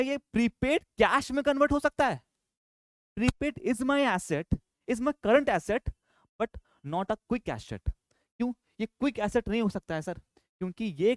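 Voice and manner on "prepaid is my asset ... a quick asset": very slow, word by word, as if for students to write down; slow dictation. Shown as a demonstration. Teacher dictating a note to the class, very slowly. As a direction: spell it out slowly so the class can copy it.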